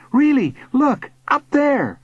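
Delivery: high, excited pointing out; urgent insistence. A narrator's reading. Boy urging the bully to look, urgent. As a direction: make them look up right now.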